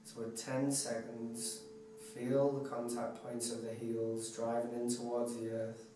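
hushed, unhurried guide into deep relaxation; guided meditation. Acted. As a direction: talk them softly through a body scan, letting each phrase settle. Leads relaxation body scan.

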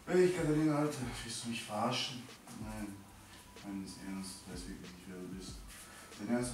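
hushed, low read-through of messages; softly reading texts off a phone; quiet chat reading. Acted.

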